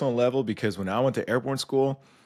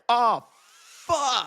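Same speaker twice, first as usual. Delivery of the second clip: high, louder groan of dread with a laugh; 12 semitones higher, 4 dB louder than usual. Retold or imitated. Jumper hearing stand by at the open door, high laughing dread. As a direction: moan it in comic doom. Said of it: "And I'm like".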